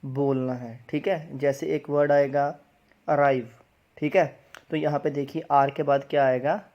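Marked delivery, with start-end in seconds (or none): 3.1-3.5 अराइव with a clearly sounded r; R pronounced. Shown as a demonstration. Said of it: "र वाला sound बोलना है". (अराइव में र का साफ़ उच्चारण)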